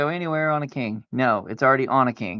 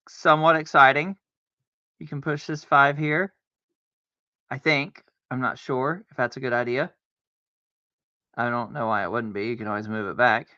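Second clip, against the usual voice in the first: ordinary delivery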